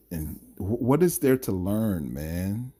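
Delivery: ordinary talk, nothing marked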